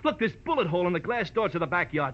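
frantic pointing. Acted. Dave frantic over bullet hole.

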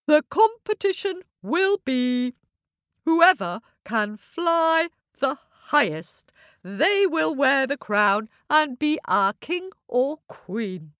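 owl's grand announcement; owl announcing. A narrator's reading. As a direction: declare the rules to all the birds.

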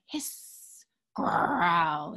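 animal noises. Acted.

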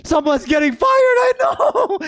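high outcry that cracks at the top; voice cracking. Real, not acted. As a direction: shout up high until the voice breaks. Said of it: "Oh, my voice just cracked".

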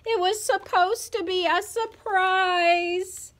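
put-on high, whiny child's cry; storybook crying-child voice; tearful wail. A narrator's reading. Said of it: "Ivy and Hackett started to cry".

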